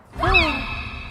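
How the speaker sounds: startled intake of breath; sharp gasp. Real, not acted. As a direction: gasp in fright. Fright, gasp.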